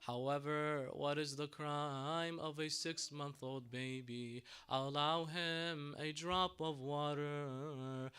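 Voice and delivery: slow grieving plea. Retold or imitated. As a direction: drag each word out in sorrow. Imam Hussein pleading for water.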